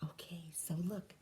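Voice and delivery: unvoiced hushed aside; whisper. Real, not acted. Pointing out the picture to children, whispered. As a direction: lean in and whisper.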